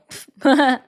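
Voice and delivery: short, loud burst of laughter; sudden amused cackle; real laugh. Real, not acted. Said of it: "kinda made me laugh".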